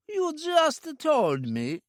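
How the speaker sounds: high, slow feigned confusion; puzzled villain. A narrator's reading. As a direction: play dumb, drawn out.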